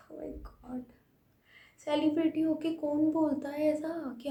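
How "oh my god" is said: breathless whisper, much softer; whispered oh my God. Real, not acted. Fan overwhelmed by the star's sweetness, whispering. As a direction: whisper it in awe.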